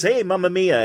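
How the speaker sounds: put-on heavy comic Italian accent; hammed-up stage Italian. Imitating an old radio sitcom immigrant, Italian accent. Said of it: "doing an Italian accent".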